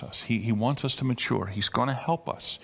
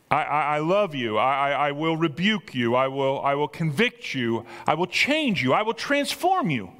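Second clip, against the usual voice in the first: impassioned proclamation; 3 semitones higher than usual. Acted. Preacher as Jesus promising the church change, impassioned and building.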